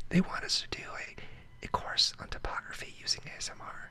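soft breathy ASMR whispering. Acted. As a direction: whisper close to the mic.

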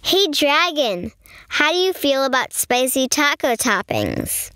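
plain reading voice

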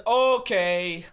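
mock impression of a friend saying okay; mimicking him. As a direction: echo his okay in a mock voice, rising. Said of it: "He says".

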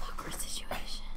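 hushed awkward whisper. Real, not acted. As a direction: whisper it under your breath. Awkward moment at neighbor's door.

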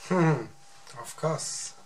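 normal talking voice

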